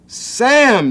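very high, loud scream of the name. A narrator's reading. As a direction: shriek at him to stop. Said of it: "screamed Marcia".

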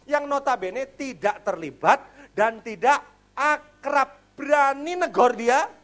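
fiery preaching. Acted. Preacher hammering his point to the congregation, loud and rising. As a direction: drive each point home to the church.

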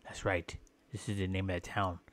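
ordinary delivery

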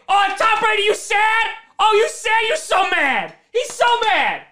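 high-pitched mocking jeer; teasing sing-out taunt; gleeful taunting. Real, not acted. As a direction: rub it in at a rival. Taunts the opposing quarterback.

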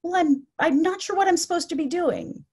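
high, worked-up uncertainty, acted; anxious agitation. Resident unsure what to do, anxious and agitated. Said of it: "her anxiety and anger".

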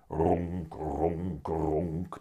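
low imitated purr "ronk"; cat purring. A narrator's reading. The cat purrs as Willem strokes him, low purr. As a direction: purr in slow, low separate rumbles. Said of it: "doet Dikke Teun".

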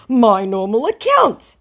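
high, loud put-on voice; hammed-up protest; insisting scammer.

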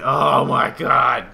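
drawn-out, high, loud outburst; stretched, shouted reaction; loud exclamation. Real, not acted. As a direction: burst out slowly and loudly in disbelief. Reacts to sudden mishap in game.

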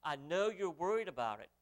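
ordinary talk

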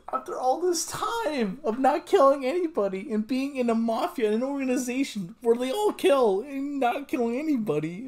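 high, excited disbelief; rising shock; stunned outburst. Real, not acted. Shocked reaction to anime twist.

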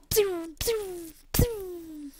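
high falling zaps; imitated gunfire. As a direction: make laser shots with the mouth. Imitates weapons firing.

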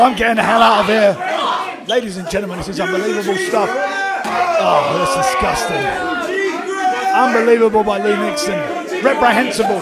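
ordinary talk

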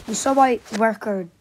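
ordinary delivery

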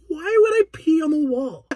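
put-on high, loud, slow kid whine; fake-innocent kid. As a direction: whine it like a wronged little boy. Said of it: "my only excuse".

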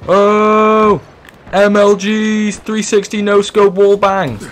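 high, loud, slow hyped Call of Duty gamer shout; Call of Duty callout. Acted. Gamer mocking Call of Duty callouts with a high, loud, slow hype shout. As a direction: shout it out like a mock-hyped trickshot kid. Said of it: "Call of Duty call outs".

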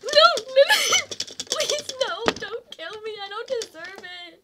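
high pleading, half laughing; mock begging. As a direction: beg for your life, hammed up. Begs the killer in game.

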